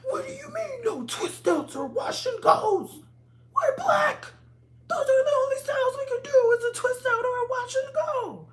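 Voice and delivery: high, loud, whiny mock shout; mocking brainwashed naturals. Mocking people who only know twist outs, in a high shrill shout. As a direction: screech it like the know-it-all she mocks. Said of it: "literally brainwashed".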